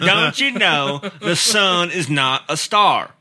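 slow, drawling Southern scolding; Southern accent. Acted. Prank caller schooling a friend on the sun, Southern accent. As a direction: explain it slowly in a put-on Southern accent. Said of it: "you guys are doing Southern again".

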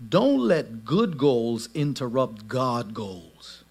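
slow, low, weighty key line; solemn slow takeaway; slow emphasis. Shown as a demonstration. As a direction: lay each word down slowly. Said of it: "key line delivered slowly".

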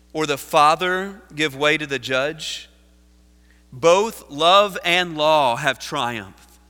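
slow, loud oratorical quotation reading; emphatic preaching. Acted. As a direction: pose it grandly to the congregation, slowly. Reads quotation in preaching force.

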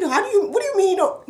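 high, fast disbelieving question; incredulous. Retold or imitated. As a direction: ask it like you can't believe it. Retold shocked question.

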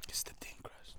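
ASMR whisper. Pretend. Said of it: "ASMR bit".

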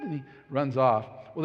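ordinary delivery

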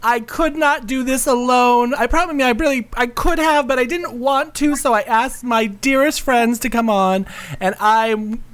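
lightly high diva host; femme fatale. Acted. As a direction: coo at the darlings. Hosts in her show persona.

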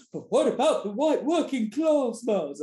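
high, put-on protesting voice; whining objector. Mimicking someone objecting about class, whining.

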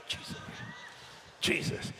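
ordinary talk

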